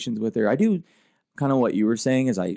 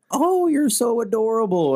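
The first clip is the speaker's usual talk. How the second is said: put-on much higher, slow cooing old lady; cooing old woman; 12 semitones higher, 4 dB louder, slower than usual. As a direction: mimic a ninety-year-old woman gushing over a child. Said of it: "she'll be like".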